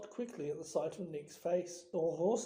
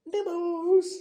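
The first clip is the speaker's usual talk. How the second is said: high, loud singsong offer; sugary high trill; sweet, teasing poltergeist; 12 semitones higher, 10 dB louder than usual. A narrator's reading. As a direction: sweetly sing it out, pitched well up. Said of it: "he said sweetly".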